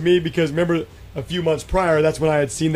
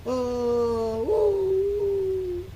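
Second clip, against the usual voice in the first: high hooting "oh, woo" imitating a distant creature call; eerie faraway whoop; mimicked far-off call; 4 dB quieter than usual. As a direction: call it out like something far off in the pasture. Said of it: "you hear this".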